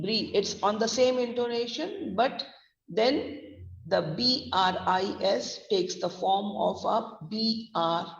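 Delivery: ordinary talk, nothing marked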